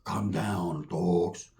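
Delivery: low, soft, settling someone down; calming low voice. Retold or imitated. Toko to the angry Samoan at the door, low calm. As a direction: say it deep and hushed to calm him.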